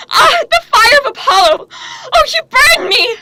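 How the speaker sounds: high, loud cry of pain as the god's fire burns her; anguished cry. Acted. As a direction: cry out as if burning from inside, fast. Cassandra seized by Apollo's fire.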